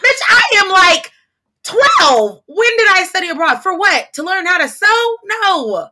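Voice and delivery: high, loud incredulous scoff; indignant retort. Retold or imitated. Girl snapping at shoppers who ask about studying abroad, loud and incredulous. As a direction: fire back as if the question is absurd. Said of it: "And I'm like".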